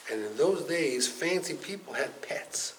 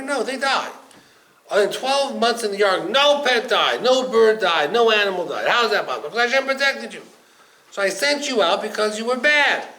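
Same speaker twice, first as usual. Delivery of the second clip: raised voice; 7 semitones higher, 11 dB louder than usual. Acted. Raven argues with Noah.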